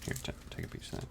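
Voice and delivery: whispered offer; whispering. Real, not acted. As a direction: whisper it quickly. Offering chocolate, unvoiced.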